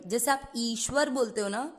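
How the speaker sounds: ordinary delivery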